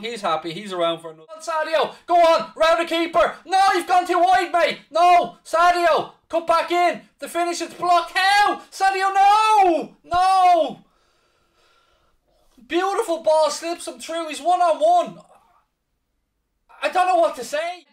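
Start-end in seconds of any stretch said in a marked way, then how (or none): 1.4-10.8 shouting high at the match; excited live commentary. Real, not acted. 12.7-17.9 excited commentary. Real, not acted. Live match reaction.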